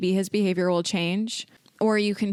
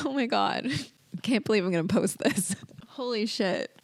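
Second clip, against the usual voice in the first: embarrassed teary laugh; 2 semitones higher than usual. Real, not acted. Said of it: "why am I emotional".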